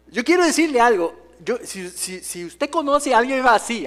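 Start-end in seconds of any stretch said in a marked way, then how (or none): none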